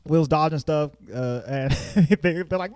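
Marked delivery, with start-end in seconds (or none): none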